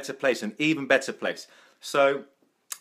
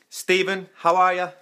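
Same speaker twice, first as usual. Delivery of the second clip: higher-pitched, playful hint of Irish; slight Irish accent; 4 semitones higher, 4 dB louder than usual. Shown as a demonstration. Greeting Stephen on the live video, slight Irish accent. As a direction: greet with a light Irish lilt. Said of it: "my Irish accent, just slightly".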